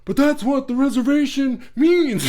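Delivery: high, loud, laughing Seinfeld-style whine; Jerry Seinfeld impression. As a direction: do your best Seinfeld, laughing through it. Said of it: "in my best Seinfeld voice".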